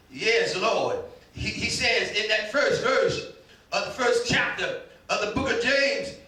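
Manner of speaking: ordinary talk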